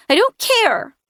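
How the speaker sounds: put-on high, loud sulky boy; a hurt child lashing out; upset child. Sam refusing comfort, high upset child voice.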